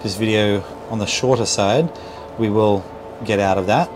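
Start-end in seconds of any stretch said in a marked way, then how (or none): none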